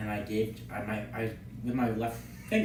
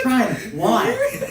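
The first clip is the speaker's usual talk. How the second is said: exasperated teacher; 10 semitones higher, 6 dB louder than usual. Retold or imitated. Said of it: "our manager, goes".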